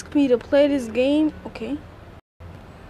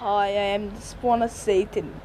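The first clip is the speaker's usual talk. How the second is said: sneering panto villain; 7 semitones lower, 4 dB quieter than usual. Pretend. Playing villain character.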